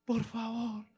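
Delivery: very high, very slow, loud desperate shout. Acted. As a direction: scream for mercy with everything left. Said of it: "él grita más fuerte".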